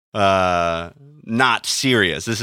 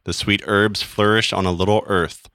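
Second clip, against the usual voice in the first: poem reading; 2 semitones lower than usual. Acted. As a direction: read it like a somber poem. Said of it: "I'm going to read this poem now".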